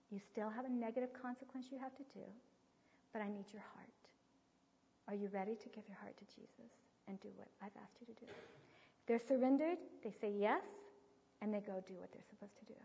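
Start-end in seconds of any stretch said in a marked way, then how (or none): 5.1-8.3 put-on whispered plea to the child; quiet whispered question to a child; hushed coaxing. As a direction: breathe it softly, asking for his heart. Mother coaxing child to surrender.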